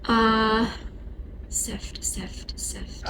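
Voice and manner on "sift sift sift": breathy repeated whisper. Retold or imitated. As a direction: whisper the word, unvoiced. Repeating the word the box said.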